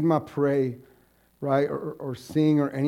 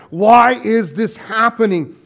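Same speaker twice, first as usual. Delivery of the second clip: slower, loud lament; retold cry; 7 semitones higher, 12 dB louder, slower than usual. Pastor voicing Irma again, slow loud lament. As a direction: stretch out the anguished why. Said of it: "kind of thing that she would begin with".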